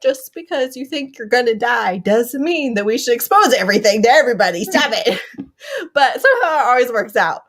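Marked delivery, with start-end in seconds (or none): none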